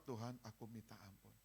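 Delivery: a quiet, earnest repentance; soft prayer. Shown as a demonstration. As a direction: murmur it humbly to God. Sample quick repentance prayer.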